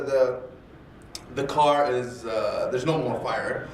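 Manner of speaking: ordinary delivery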